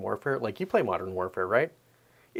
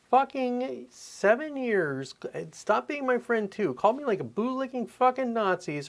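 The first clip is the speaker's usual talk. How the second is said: much higher, louder, slowed disbelief; outraged rant; 11 semitones higher, 3 dB louder than usual. Real, not acted. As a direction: vent real outrage at them. Outraged rant at former friends.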